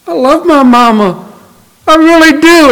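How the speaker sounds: imitated tearful girl, pitched very high; crying like little girl. Janet tearfully insisting she loves her mama, crying like a girl. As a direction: plead in a high, mock-crying child voice. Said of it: "she started crying like a little girl".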